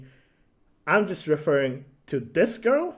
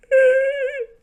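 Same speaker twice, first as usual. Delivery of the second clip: fake sob; 6 dB louder than usual. Fake sob after the whine.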